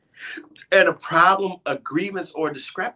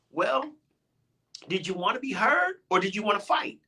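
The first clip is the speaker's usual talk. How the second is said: mock defensive retort; 8 semitones higher than usual. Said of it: "we're like".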